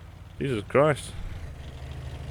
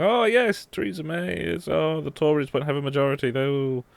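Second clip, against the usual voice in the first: high mocking voice; mocking Twitter users; 6 semitones higher than usual. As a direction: mimic the panicked posters. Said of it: "everyone seems to be going".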